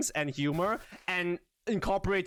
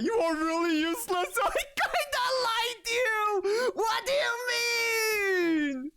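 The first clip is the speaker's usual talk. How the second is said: mock wailing; slower than usual. Reacting to teasing chat.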